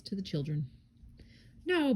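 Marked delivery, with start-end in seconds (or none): 1.7-1.9 mild storybook cry of protest; understated exclamation; cried. A narrator's reading. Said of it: "Boris cried".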